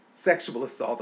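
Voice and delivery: usual speaking voice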